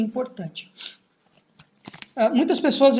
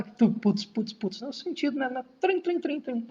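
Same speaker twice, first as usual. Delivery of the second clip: mock beat sounds; 3 semitones higher, 7 dB quieter than usual. Imita batida e teclado.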